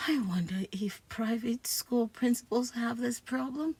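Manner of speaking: hushed musing; whisper. Acted. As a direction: think it aloud in a whisper. Inner aside while principal watches.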